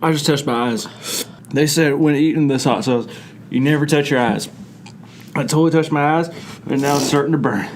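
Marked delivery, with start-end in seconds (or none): none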